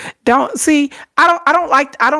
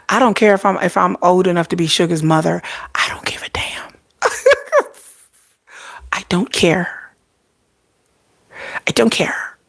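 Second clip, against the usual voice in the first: whispered defiance; 3 semitones lower than usual. Real, not acted. To viewers about Sugar, in a whisper. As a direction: confide it like a secret.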